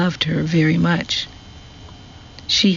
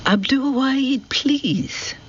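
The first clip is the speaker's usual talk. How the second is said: raised voice, pitched much higher; calling out; 10 semitones higher, slower than usual. A narrator's reading. Jasmina calls out to Abdul Wahid at prayer, high pleading call. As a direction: call to him across the garden, then a pleading please. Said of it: "raised her voice and called out to him".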